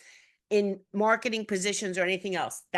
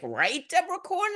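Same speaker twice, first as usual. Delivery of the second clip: high, rising mock question; imitated eager tagger; 10 semitones higher than usual. People tagging her into a thread, mock high questioning. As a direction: put on a prodding, high-pitched appeal.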